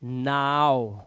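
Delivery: नाव drawn out very slowly and low; slow demo. Shown as a demonstration. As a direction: stretch नाव out, syllable by syllable. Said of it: "क्या पढ़ते हैं इसको?". (नाव को बहुत धीरे खींचकर बोलो)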